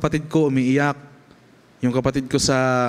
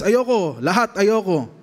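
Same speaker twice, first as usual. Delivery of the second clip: stubborn refusal; 7 semitones higher, slower than usual. Retold or imitated. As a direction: refuse like a sulky kid. Son refusing father repeatedly. (matigas na pagtanggi, mataas at malakas)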